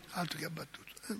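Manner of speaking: a sudden whispered comment; whispered aside. Real, not acted. Priest confiding to his flock about the workers, whispered. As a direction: breathe it unvoiced, as an aside.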